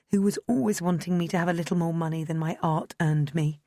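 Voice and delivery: ordinary delivery, nothing marked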